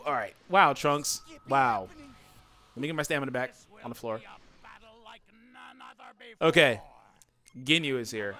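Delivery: ordinary talk, nothing marked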